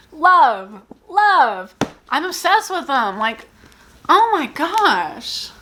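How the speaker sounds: high, gushing delight; excited gushing. Real, not acted. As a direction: gush over it. Gushing over favorite books.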